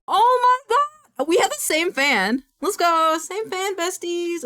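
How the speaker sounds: high, loud delighted squeal; excited squeal. Real, not acted. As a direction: squeal with joy at the match. Delighted at seeing a shared fan.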